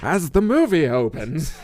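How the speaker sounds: mock movie narrator voice; grand trailer-style narration; dramatic narrator. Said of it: "Don't narrate the whole time".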